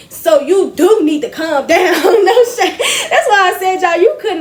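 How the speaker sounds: loud, laughing emphasis. Real, not acted. Storyteller joking that she did need to calm down, laughing. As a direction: break into a real laugh, pitch climbing. Jokes about the pregnant lady, laughing.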